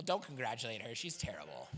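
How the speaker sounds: ordinary talk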